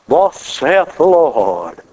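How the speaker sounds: pompous mock prophecy, drawn out; mock pulpit voice. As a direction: ham up a stiff old-time prophet. Mocking churchy pronouncements.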